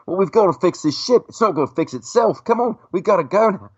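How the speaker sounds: put-on high-pitched English-accented fussing; Peter Mayhew. Actor in the mask urging his co-star, English accent. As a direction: hurry him along in an English accent. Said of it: "talking with this English accent".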